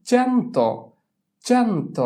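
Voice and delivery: চ্যান্ত high on the first syllable, falling after; pronunciation demo. Shown as a demonstration. Teacher dictates the Italian for a hundred, চ্যান্ত high and clearly sounded. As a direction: model চ্যান্ত clearly, pitched well up. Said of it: "আমি উচ্চারণ বলে দিব".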